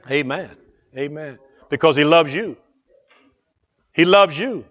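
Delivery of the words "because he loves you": ordinary delivery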